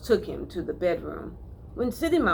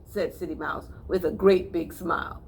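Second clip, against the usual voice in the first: usual speaking voice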